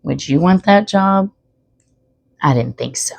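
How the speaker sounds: ordinary delivery, nothing marked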